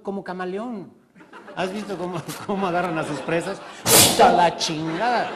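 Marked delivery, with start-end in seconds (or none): 3.8-5.3 loud slow laughing outburst; loud laughing exclamation. Real, not acted. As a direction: cry out in laughing awe. Exclaiming at the predator.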